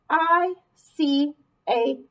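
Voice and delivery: put-on slow, clear model reading for children; teacher models the sentence slowly for the class. Shown as a demonstration. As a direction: read it slowly so they can follow. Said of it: "Read it with me".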